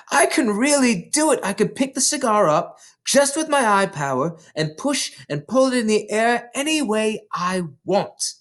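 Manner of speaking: put-on high, delighted child; brimming with triumph; joyful triumph. Matilda rejoices at controlling the cigar, high and excited.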